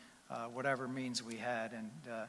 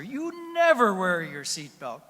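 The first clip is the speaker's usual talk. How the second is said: slow, loud and higher accusation; loud scolding; 6 semitones higher, 12 dB louder, slower than usual. Retold or imitated. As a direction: hammer each word at him. Wife shouts the line again.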